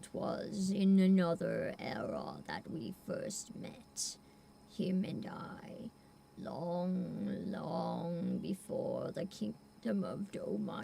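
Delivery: put-on low slow old tiger murmur; old man tiger voice. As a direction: speak low like an ancient tiger. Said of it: "weird old man angry tiger voice".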